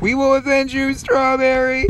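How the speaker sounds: high, rallying cry; high-pitched battle vow; mock heroic vow. Real, not acted. Vowing revenge for fallen teammate.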